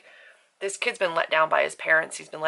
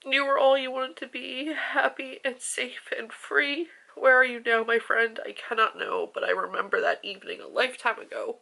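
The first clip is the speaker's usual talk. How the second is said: poem reading; 7 semitones higher than usual. Acted. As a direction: read the verse gently, lingering on each line. Reads a poem from the book.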